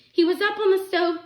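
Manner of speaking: high, loud, urgent explanation; sickened. A narrator's reading. Harvey explains the accident, distressed protest. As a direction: blurt it out in distress. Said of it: "sickened by what had happened".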